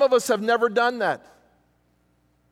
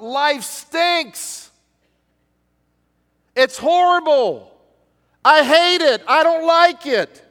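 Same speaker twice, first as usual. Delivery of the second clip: higher and louder, crying out to God; honest cry out; 5 semitones higher, 7 dB louder, slower than usual. Retold or imitated. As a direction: let the frustration burst out. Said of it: "No, he's saying".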